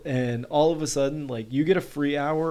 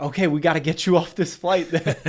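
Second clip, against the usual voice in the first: laughing through it; 5 semitones higher than usual. Retold or imitated. Retelling attendant, laughing.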